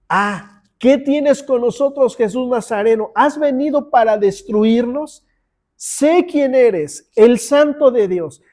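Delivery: demon crying out. Acted. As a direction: cry out as the unclean spirit confronting Jesus. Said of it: "que dio voces diciendo". (gritos del espíritu inmundo, fuertes y lentos)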